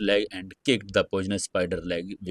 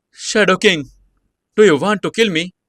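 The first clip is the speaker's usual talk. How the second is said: high, loud frightened plea; fearful; 10 semitones higher, 7 dB louder than usual. Acted. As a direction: beg for your life in fear. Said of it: "he said in fear".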